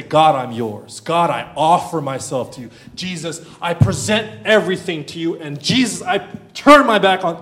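loud, urgent declaration to God; fervent model prayer. Acted. Preacher leads listeners in surrender to God, fervent prayer. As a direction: pray it out boldly, building in force.

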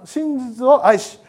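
「真実を愛し」を一語ずつゆっくり区切って; 「真実」を噛みしめるようにゆっくり; slow emphasis. Shown as a demonstration. (ゆっくり強調して)

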